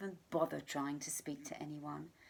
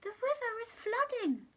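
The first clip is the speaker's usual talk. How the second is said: much higher, squeaky voice; squeaky giraffe. Acted. Giraffe squeaking a warning to the animals. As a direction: squeak it out like a tiny creature. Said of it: "in a surprisingly squeaky voice".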